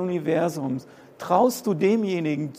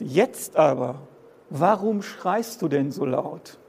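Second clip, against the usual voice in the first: slow scripture reading; 2 semitones lower than usual. Shown as a demonstration. Preacher reads Micah's question to the congregation, slow and calm. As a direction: read the verse slowly and evenly.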